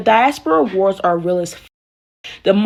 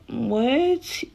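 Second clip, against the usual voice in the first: a short, disbelieving what, pitched up; stunned what; 4 semitones higher, 7 dB quieter than usual. Retold or imitated. Reacting to the friend's advice with disbelief. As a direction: caught off guard by the friend's advice. Told of anti-African advice.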